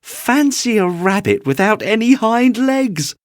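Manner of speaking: high, loud jeering cry; mocking wild rabbit. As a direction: crow over the little rabbit. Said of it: "he began to laugh".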